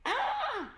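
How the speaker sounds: very high scream; shocked shriek. A narrator's reading. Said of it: "screamed Jemima".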